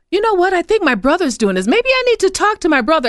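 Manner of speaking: high, animated voice of a woman she met; woman retold. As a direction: voice the surprised lady with a lifted pitch. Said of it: "And she says".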